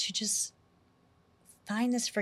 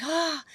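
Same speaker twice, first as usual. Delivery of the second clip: a sharp, high cry of pain, retold; 8 semitones higher than usual. Reliving the ceremony pain, a high mock cry. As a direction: yelp up high like a sudden stab of pain. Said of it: "I was screaming".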